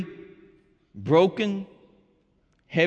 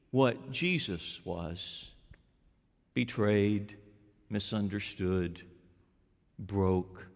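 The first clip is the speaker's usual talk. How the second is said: hushed sorrowful listing; 10 semitones lower, 10 dB quieter, slower than usual. Real, not acted. Listing Jesus' suffering.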